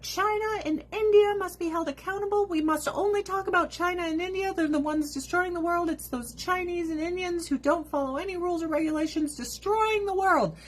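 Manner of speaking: high, insistent parody of an activist; high-pitched mock indignation; mock activist outrage. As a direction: plead it up high as a protester would. Voicing what sincere activists would say.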